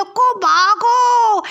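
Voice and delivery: loud, high, long-drawn yell; old woman yelling. Acted. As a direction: scream the name across the house. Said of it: "इतनी तेज़ चिल्लाती है". (बुढ़िया की ज़ोरदार लंबी चीख़)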